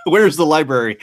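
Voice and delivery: laughing through the question. Real, not acted. Host laughing at his own broken Spanish, laughing. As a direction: giggle it out, barely holding it together.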